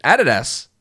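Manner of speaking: ADIDAS as "AD-ee-das"; Australian Adidas. Shown as a demonstration. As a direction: stress the first syllable of "Adidas". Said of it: "I say it with an Australian accent".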